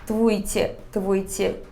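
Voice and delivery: "твуйте" said softly, syllables built backwards; sounding out words. Shown as a demonstration. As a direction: repeat "твуйте" slowly and carefully. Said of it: "começa a falar ela sílaba por sílaba, de trás pra frente". (pronunciando sílaba por sílaba)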